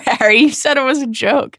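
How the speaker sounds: high-pitched mock shout; mild yell of outrage. A narrator's reading. Ron hanging upside down, half-shouting at Harry. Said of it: "shouted Ron".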